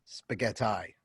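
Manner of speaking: spaghetti whispered, ending in "eye". Shown as a demonstration. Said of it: "but you don't call spaghetti".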